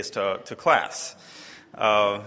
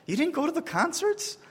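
very high, disbelieving squeak; aghast. Retold or imitated. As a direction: sound crushed that he skipped them. Retelling his dismay over the concerts.

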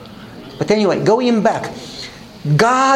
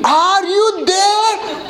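high, long drawn-out holler; mock scream; 12 semitones higher, much slower than usual. Wife calling again to her husband next door, drawn-out mock scream. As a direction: stretch the imitated call out, very high and slow. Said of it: "I could hear her screaming".

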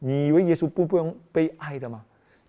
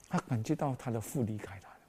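usual speaking voice

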